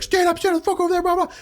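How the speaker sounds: very high, fast enraged yell; raging order. Retold or imitated. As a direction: spit out the orders in rage. Sergeant berating refusing trainees.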